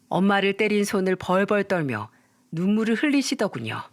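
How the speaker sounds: ordinary delivery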